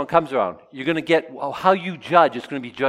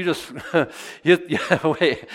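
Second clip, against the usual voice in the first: breaking into a laugh; real laugh; 2 semitones higher, slower than usual. Real, not acted. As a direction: laugh through the word. Laughs at Jesus's words.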